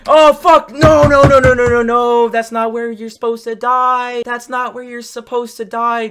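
high, loud outburst easing off; real gamer's cry of dismay; dismayed shouting. Real, not acted. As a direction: yell a panicked string of protests, then settle. Dies in the game, cries out.

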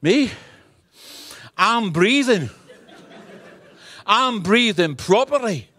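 high-pitched, hammed-up boyish protest; mock indignation, pitched well up; indignant child self. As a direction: play an aggrieved little boy answering mum. Child self protesting to his mother.

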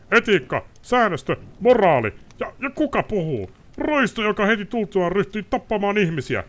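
high, loud, indignant ranting lord; haughty noble raging, sword half drawn; outraged nobleman. Acted. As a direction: spit contempt at the witcher. Ostrid's outraged tirade.

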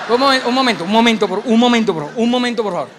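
loud, pleading for a moment. Real, not acted. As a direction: raise your voice over the laughing crowd. Pide calma al público que grita. (gritando al público que se calme, fuerte y suplicante)

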